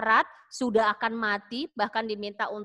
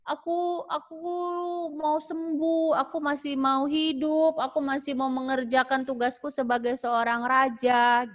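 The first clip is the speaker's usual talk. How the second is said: higher, slow, lightly voiced pleading prayer; tearful prayer; 4 semitones higher than usual. Hezekiah praying in tears to be healed, mild pleading. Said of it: "dia takut, dia sedih".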